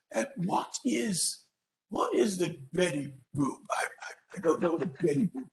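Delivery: mock-polite bewilderment over a strange menu; puzzled British diner. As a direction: hesitate and puzzle over the unfamiliar name.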